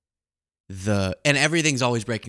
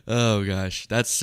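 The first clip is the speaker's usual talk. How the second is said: ordinary talk, nothing marked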